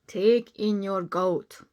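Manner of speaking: slow, lightly voiced wise old man. Wise old man advising the lady, slow and calm. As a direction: give calm slow advice. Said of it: "said the wise old man".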